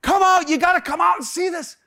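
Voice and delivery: put-on high excited child shout; kids yelling. Small sons calling Dad out from under the car, yelling. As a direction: yell it like little boys bursting with news. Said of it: "they're yelling to me".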